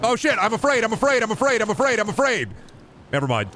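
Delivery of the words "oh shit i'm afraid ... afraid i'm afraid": mock panic. Panicked streamer facing big pig.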